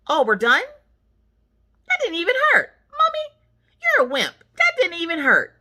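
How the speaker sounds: very high mock child voice; imitated little girl. Girl teasing mommy after shot, imitated high voice. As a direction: pipe up high like a kid.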